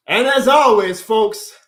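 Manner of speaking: loud, high showman's outro; rousing sign-off. Real, not acted. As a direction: belt out the catchphrase to close the show. Signature sign-off at stream's end.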